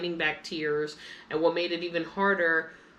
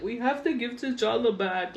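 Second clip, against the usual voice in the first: a touch of tearful higher voice; mildly tearful retelling of the call to mom; retold crying plea; 5 semitones higher than usual. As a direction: mimic the choked plea to mom. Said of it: "hysterically crying".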